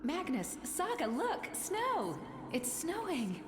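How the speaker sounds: thrilled cry about the snow; excited. Acted. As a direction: call out with delight. Wife excited by first snow.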